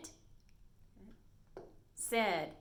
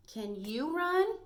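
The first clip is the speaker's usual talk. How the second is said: slow, high and louder, asking like Bella; Bella's question; 7 semitones higher, 6 dB louder, slower than usual. Shown as a demonstration. Teacher reading Bella's question to class, slow and high. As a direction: stretch out the question as a curious girl. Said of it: "said Bella".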